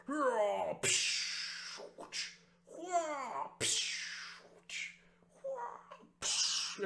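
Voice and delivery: mock battle cries and clash sounds; fight noises. Kid hero and witch trade blows, mock cries and whooshes. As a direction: play out sword clashes with your mouth. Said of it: "We're both like".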